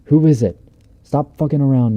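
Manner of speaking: retold panicked yell at whoever is outside. Frightened campers yelling out at the thing at the door, yelling. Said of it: "We keep yelling outside".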